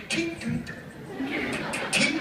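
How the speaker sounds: high clicking tink noises; remote click imitation. Explaining old remotes to a kid, high click noises.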